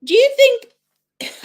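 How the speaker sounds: high incredulous question; drawn-out disbelieving ask. Real, not acted. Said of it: "incredulous question to audience".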